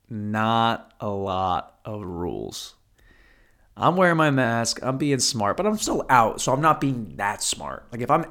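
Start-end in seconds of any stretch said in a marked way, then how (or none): 0.1-2.8 drawn out. Real, not acted. Emphatic punchline about Sixth Street.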